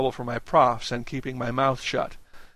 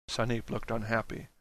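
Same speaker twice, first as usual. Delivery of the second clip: usual speaking voice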